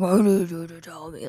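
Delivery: low, demonic imitation of a yell; deep mock yell. Boyfriend demanding an answer in the bathroom, deep mock yell. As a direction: imitate him like hell's gates opening. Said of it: "yelling it so loud, so deep".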